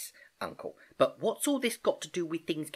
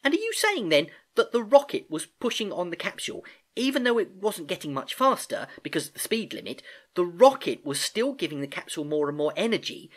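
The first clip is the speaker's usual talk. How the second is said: put-on high, louder young niece working it out eagerly; brightened up; 8 semitones higher, 4 dB louder than usual. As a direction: race to the realisation, excited. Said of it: "Gedankin brightened up".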